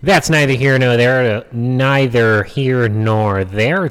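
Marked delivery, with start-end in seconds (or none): 1.5-3.9 very slow, drawn-out delivery. Shown as a demonstration. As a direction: stretch every word of neither here nor there out slowly. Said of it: "if I want to slow down my speaking".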